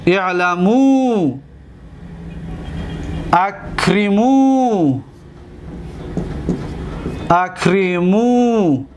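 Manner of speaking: very slow, final oo held long; slow dictation. Shown as a demonstration. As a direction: drill each form in drawn-out syllables. Teacher dictating verb forms while writing.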